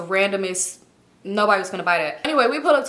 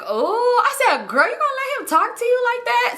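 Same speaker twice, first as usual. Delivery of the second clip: high-pitched scandalized gasp of disbelief; mock shock; 13 semitones higher than usual. Friend stirring up trouble over how he talks to her, mock shock. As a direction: egg her on in a high, incredulous voice.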